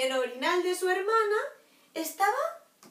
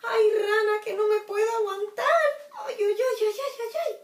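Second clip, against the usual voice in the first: 1 desperate puppy; 6 semitones higher, 7 dB louder than usual. Acted. Perrito con ganas de hacer pipí.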